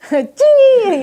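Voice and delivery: very high, loud calling voice; mock pet call. Storyteller mimics someone calling a pet, high and loud. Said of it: "What do you call people, like".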